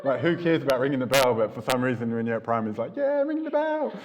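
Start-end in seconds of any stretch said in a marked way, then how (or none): 3.0-3.9 much higher, laughing mock kid excitement; eager kid. A primary school kid gushing over ringing the bell, giddy. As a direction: squeal it like a thrilled little kid.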